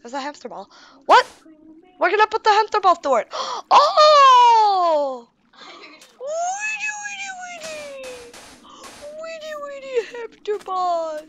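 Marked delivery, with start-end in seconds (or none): 3.3-5.3 long, high, loud cry that slides down; drawn-out high squeal; excited scream. Real, not acted. Excited over the hamster ball. 6.2-11.2 tiny, very high falsetto babble; high squealed play voice; playful squeal. Pretend. Playing with the hamster ball.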